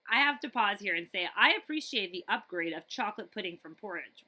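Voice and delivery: plain reading voice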